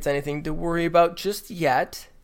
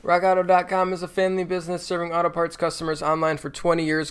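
ad read; 4 semitones higher than usual. Acted. Reads sponsor ad.

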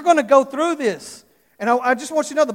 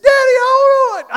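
put-on high, louder little-boy whine; whiny child; 10 dB louder than usual. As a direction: play the reluctant son. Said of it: "He said".

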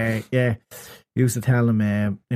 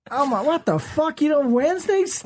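mock American shock; 13 semitones higher than usual. American gamer hearing there are no Wednesdays, mock shock. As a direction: gasp it out like you can't believe it.

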